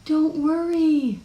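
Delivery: high, drawn-out motherly reassurance; mother's slow, high don't worry; soothing mother. Acted. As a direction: stretch it out high and lilting. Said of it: "said her mother".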